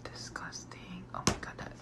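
breathless whispered disgust. Real, not acted. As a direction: whisper it, repulsed. Grossed out by oyster.

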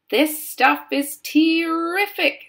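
high, loud and drawn out; gleeful relish. A narrator's reading. Augustus delighted by the chocolate, gleeful relish. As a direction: savour it with greedy delight.

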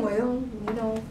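low, soft noncommittal reply; hushed, half-hearted shrug of a voice; doubting believer. Acted. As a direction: imitate a believer with no conviction. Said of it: "Some of the believers are saying".

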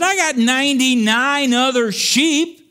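very high, projected pulpit voice; full-force preaching. Acted. Preacher imagines the shepherd's excuse aloud, high full-force preaching. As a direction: cry it out from the pulpit, stretching the words.

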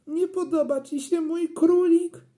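put-on high, wailing child's voice; tearful boy. A narrator's reading. Boy asking mum if she dislikes his rabbit, tearful. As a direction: ask it on the edge of tears. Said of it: "zacząłem płakać".

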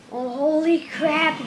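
ordinary talk

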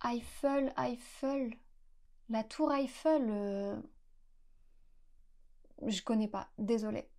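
a Parisian passer-by, softly puzzled, half to herself. Pretend. Puzzled Parisian answering a tourist's question, softly. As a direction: mutter the name back, not getting it. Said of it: "La personne vous répondra sûrement".

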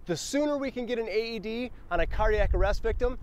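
ordinary talk, nothing marked

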